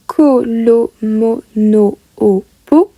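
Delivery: "ko, lo, mo, no, o, po" spoken as a careful list; letter names. Shown as a demonstration. Teacher reciting simple letters for students. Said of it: "le plus simple".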